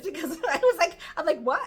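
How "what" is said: confused. Retold or imitated. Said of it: "I was so confused".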